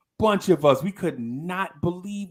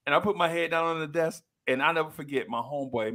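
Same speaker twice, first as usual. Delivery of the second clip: ordinary talk, nothing marked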